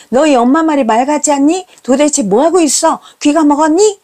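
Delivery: high-pitched nagging demand; exasperated scolding. Retold or imitated. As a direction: berate kids who won't come out. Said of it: "온갖 소리를 해댑니다".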